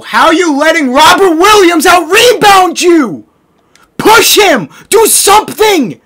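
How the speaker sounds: high, loud exasperated shouting; outraged yelling. Real, not acted. Gamer to teammate losing rebounds, outraged shouting. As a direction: berate a teammate for getting bullied.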